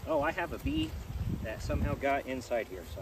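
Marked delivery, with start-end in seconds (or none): 0.1-0.9 sudden high startled cry; surprised. Real, not acted. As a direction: yelp at a bee inside. Finds a bee inside veil.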